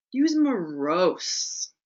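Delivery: very slow, drawing out Use morose; slow emphasis. Shown as a demonstration. Teacher dwelling on the word morose, very slow. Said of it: "demo of evocative word".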